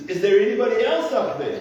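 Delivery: high comic call for someone else; calling upward. Acted. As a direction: deliver the punchline as a hopeful shout upward. Joke punchline, man calls past God.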